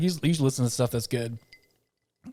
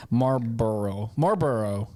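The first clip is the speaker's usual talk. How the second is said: Southern drawl; 2 semitones lower, slower than usual. Real, not acted. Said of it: "I'm Southern".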